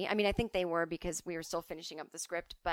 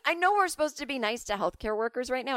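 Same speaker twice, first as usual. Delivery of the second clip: ordinary talk, nothing marked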